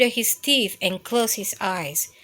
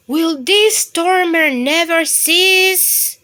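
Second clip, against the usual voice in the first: exasperated; 8 semitones higher, 8 dB louder than usual. Acted. Bear exasperated by noise.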